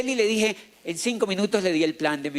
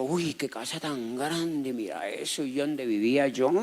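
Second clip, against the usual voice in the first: lower, softer, slow, hushed wonder; awed murmur; 7 semitones lower, 6 dB quieter, slower than usual. Retold or imitated. A poor boy staring at a huge house, hushed awe. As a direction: marvel under your breath at the mansion.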